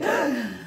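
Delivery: sharp acted intake of breath; startled storybook gasp; sudden gasp. Heroine suddenly realizes the danger.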